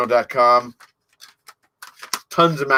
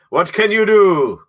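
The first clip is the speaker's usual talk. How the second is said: higher and louder, resigned; louder shrug; 6 semitones higher, 8 dB louder than usual. Real, not acted. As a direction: throw the question out loud. Rhetorical shrug, loud first take.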